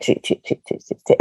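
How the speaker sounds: whispered echo fading away. Shown as a demonstration. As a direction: whisper it like an endless mirror echo. Imitating repeating mirror echoes.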